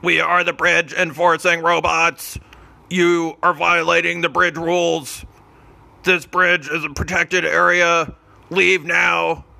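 stiff, announcing like a robot guard. Acted. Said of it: "heard a robot-y voice".

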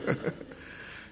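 short breathy chuckle; soft tail end of a laugh; trailing laugh. Real, not acted. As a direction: let the laughter die away softly. Laughter tailing off after praise.

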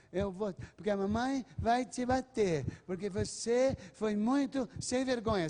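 hammed-up scolding, stretched word by word; scolding mother. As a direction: imitate a nagging mum threatening a child. Mocked scolding mother threatening to spank.

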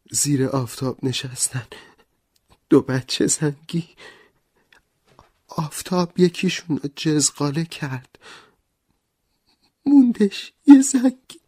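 high, tearful rhyme rising to a cry; choked up. Acted. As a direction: recite with a lump in the throat. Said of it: "با بغض خواند".

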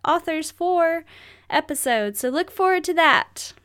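usual speaking voice

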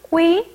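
꾸이 with a tense k; northern Vietnamese reading. Shown as a demonstration. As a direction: sound out 꾸이 with a hard k onset. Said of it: "북부에서는".

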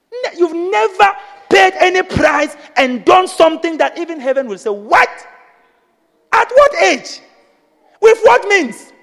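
rising preacher shout, high and loud; heaven's astonished cry, loud and high. Acted. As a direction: build it up to a full shout.

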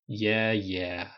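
half-hearted grumble; mildly grudging assent; pro forma grumbling. A narrator's reading. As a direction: brush off the nagging. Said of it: "promo forma grumbling".

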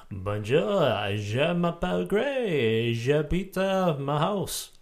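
loud, hammed-up bad French accent, pitched high; mock French accent. Recalling French lessons at school, hammed-up French accent. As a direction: schoolroom French, slowly drawn out with an exaggerated accent. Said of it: "sound like the guy out of, uh, the, uh, Pink Panther".

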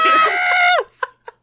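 spluttering laugh. Real, not acted. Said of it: "I have water in my mouth".

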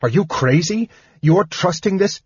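high-pitched outraged disbelief; heated, high-pitched objection; incredulous yelling. Acted. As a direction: protest in disbelief, voice rising. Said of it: "he yelled".

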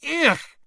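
very high, loud squeal of disgust. A narrator's reading. Girl recoils from creature, squealing in disgust. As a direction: recoil with a shrill "Ich!". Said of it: "said Lavender Brown again".

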